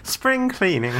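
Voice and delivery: high-pitched hammed-up fake Irish; mock Irish accent. Contestant adds another try, high mock Irish. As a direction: squeal it in a badly faked Irish lilt. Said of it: "let's hear your best Irish accent".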